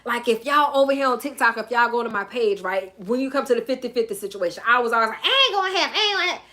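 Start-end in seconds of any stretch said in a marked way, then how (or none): 5.2-6.4 high-pitched, half-laughing refusal; mock your old stubborn self; protesting self-quote. Host mimicking her old refusal, high-pitched and protesting.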